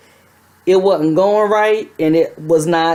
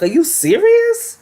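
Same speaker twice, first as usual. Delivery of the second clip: much higher, slow squeal of disbelief; shocked disbelief; slower than usual. Retold or imitated. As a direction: squeak it out in total surprise. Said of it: "I was like".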